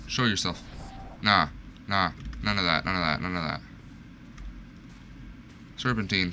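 usual speaking voice

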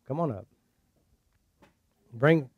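ordinary talk, nothing marked

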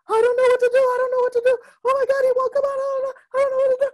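high, loud panicked falsetto mother; frantic mother. Retold or imitated. Teacher voicing a frantic mother on the phone, high panicked squeal. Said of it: "the mother called, frantic".

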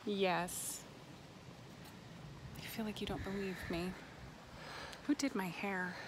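ordinary talk

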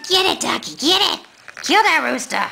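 high, eager cheering on; excited urging. Real, not acted. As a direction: egg the dog on, excited. Urging dog to play.

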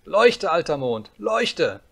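put-on high, loud little-boy shouting; commanding cry. A narrator's reading. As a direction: order it loudly like a bossy child. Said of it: "schrie Häwelmann". (herrisches Kindergeschrei)

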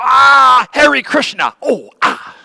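loud high yelp of pain, hammed up; comic cry of pain swearing by Hare Krishna; mock pained cry. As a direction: pretend you slammed your fingers in the door. Mock swearing, fingers caught in door.

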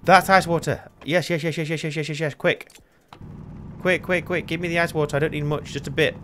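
high, rapid-fire panicked repeats; frantic urgency. Real, not acted. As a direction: race for it before time runs out. Spots water, almost out, frantic.

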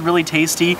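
ordinary talk, nothing marked

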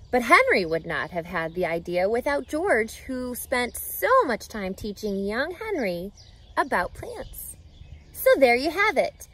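ordinary delivery, nothing marked

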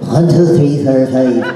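imitated Irish accent from a tape; Irish brogue. Actor recalling the accent tape from college, Irish brogue. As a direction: count in a put-on Irish brogue. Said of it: "now in the Irish brogue".

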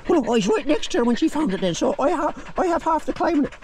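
high silly bragging dog voice; voicing the dog. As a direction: boast like the dog who won the fight. Dog voice claiming half the pig.

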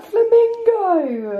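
very high, slow drawn-out word; naive exclamation. Retold or imitated. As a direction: blurt it out naively. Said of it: "was like".

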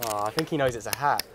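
ordinary delivery

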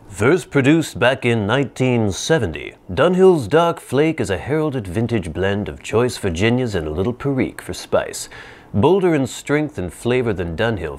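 product blurb read aloud; reading a description. Acted. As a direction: read it like a catalog entry.

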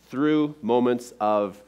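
ordinary talk

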